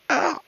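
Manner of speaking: short high, loud yelp; sudden acted squeal of pain. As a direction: cry out as if grabbed. Duke yanked by Leo, cries out.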